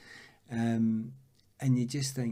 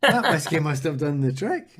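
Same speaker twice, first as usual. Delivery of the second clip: loud, higher, laughing delivery; 5 semitones higher, 11 dB louder than usual. Real, not acted. Footballer agreeing the whiskey helped, laughing loudly. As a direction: top the joke through hearty laughter.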